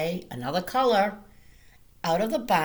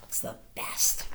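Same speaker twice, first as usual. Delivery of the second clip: soft whispered aside; 6 dB quieter than usual. Real, not acted. Reading to children, a whisper. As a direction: whisper it like a secret.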